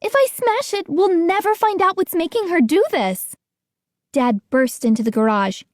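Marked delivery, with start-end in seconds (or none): 0.0-3.4 urgent protest. A narrator's reading. Boy protesting to Gates.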